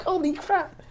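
very high holy crap; squeaky shock. Retold or imitated. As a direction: squeal it in disbelief.

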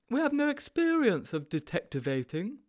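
high-pitched, naive sidekick; high storybook character voice; Sponge's character voice. A narrator's reading.

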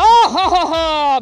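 loud, hearty mock guffaw; laughing tiger. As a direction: laugh off a tiny attacker. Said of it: "the tiger was like".

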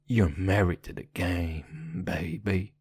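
low, slow sexy drawl; deep rapper voice. Acted. Gangster rapper persona, slow deep voice. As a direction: savor it low like a smooth gangster.

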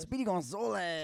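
Speedy Gonzalez. Acted. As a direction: sing out the name like a cartoon hero. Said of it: "how does he say it".

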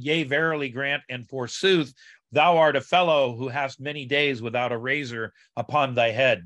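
ordinary talk

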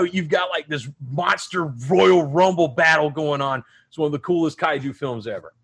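usual speaking voice

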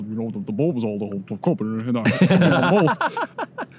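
small-mouthed Midwestern friend impression; Midwestern accent. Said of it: "He's got that Midwestern".

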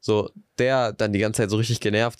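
ordinary talk

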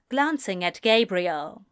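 plain reading voice

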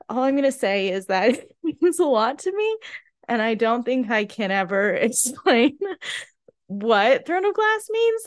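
laughing through tears, voice climbing high; a choked, laughing admission; teary laughter. Real, not acted. Fan explains what the series means to her, laughing through tears.

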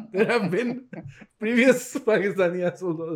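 laughing. Real, not acted. Host laughing at the newcomer joke. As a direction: laugh while starting the line.

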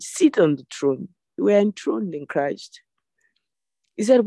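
normal talking voice

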